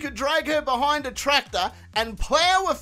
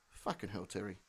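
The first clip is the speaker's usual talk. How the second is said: exasperated mutter; 18 dB quieter than usual. Real, not acted. Exasperated after Terry's story.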